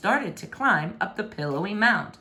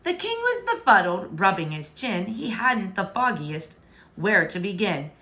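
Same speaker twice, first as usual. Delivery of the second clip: ordinary delivery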